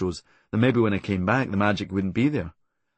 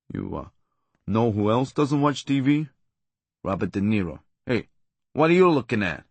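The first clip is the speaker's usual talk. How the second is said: Robert De Niro impression. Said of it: "in the voice of Robert De Niro".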